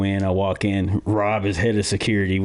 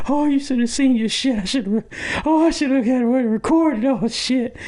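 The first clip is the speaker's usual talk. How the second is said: high, giddy voice, cracking up; laughing friend; 14 semitones higher than usual. Retold or imitated. The prankster friend gloating through tears of laughter. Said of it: "he just got tears in his eyes".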